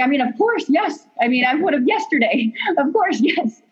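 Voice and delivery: high, giddy yes with a laugh; bursting into a laughing, high-pitched yes; excited yes. Retold or imitated.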